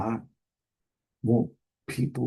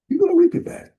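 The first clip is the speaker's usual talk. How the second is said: much higher, louder cry of "reap"; emphatic preaching cry; 5 dB louder, much faster than usual. Real, not acted. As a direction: lift "reap" way up as a promise. Preacher repeats promise, rising.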